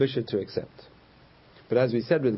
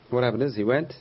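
usual speaking voice

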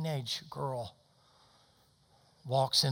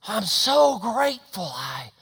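fervor subsiding; 6 semitones higher, 8 dB louder, slower than usual. Retold or imitated. Girl is grateful she died, fervor subsiding. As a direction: let the shout come down slowly to rest. Climax drops to quiet ending.

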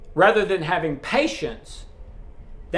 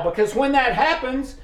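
normal talking voice

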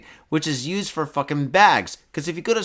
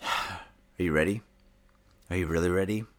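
teasing low tease; 9 semitones lower, 6 dB quieter than usual. Pretend. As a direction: tease the audience, voice dropped low. Teasing viewers before the story.

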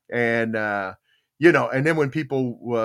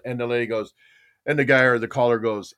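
normal talking voice

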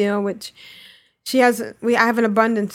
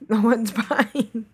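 slow, laughing repeat; slower than usual. Real, not acted. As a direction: chuckle the words out.

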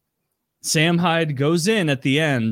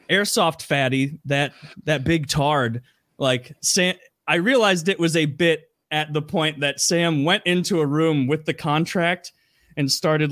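plain reading voice

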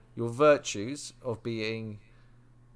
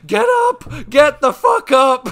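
high, loud panicked yell; frantic shout at a fallen friend to get up; screaming at a friend; 15 dB louder, slower than usual. Retold or imitated. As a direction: scream at him to get up off the ground. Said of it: "I'm just screaming at him".

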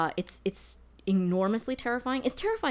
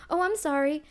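high, louder automatic apology; raised-pitch apology before the whispered twist; reflexive apology; 10 semitones higher, 5 dB louder than usual. Retold or imitated. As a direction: pipe up with a polite sorry. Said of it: "that I was like".

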